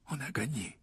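very soft, barely-there voice; faint murmur. A narrator's reading. Injured Harry about to pass out, faint murmur. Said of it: "murmura-t-il".

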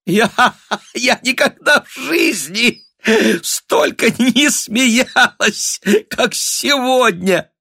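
gasping high words broken by laughs; squealing, hysterical mirth in bursts; helpless laughter. A narrator's reading. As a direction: barely get it out for laughing. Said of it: "схватилась от смеха за живот".

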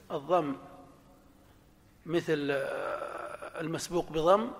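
usual speaking voice

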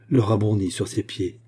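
usual speaking voice

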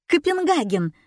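high, pleased exclamation; delighted. A narrator's reading. Heroine sure of her answer to the crowd, delighted. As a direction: blurt out the answer happily. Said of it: "обрадовалась я".

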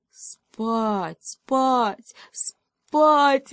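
rising sleepy urgency. Acted. Hedgehog falling asleep from cold.

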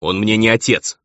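mildly angry retort; a touch of hostility; bristling. A narrator's reading. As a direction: snap back defensively. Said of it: "Мгновенно ощетинился Эмил".

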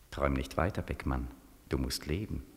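low, hushed voice from inside; low inner voice. Acted. Inner voice urging Beckmann to wake, low and hushed. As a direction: murmur it low like a voice in his head.

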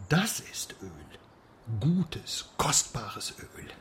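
slow, hushed, relishing whisper; savouring whisper. Acted. Whispering lovingly about oil to his drinking partner. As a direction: savour each word like a fine wine.

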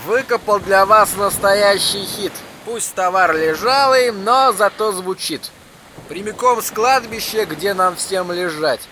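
like a crackly radio speaker; tinny pocket radio. A narrator's reading. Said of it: "натреснутый голос из радиоприёмника".

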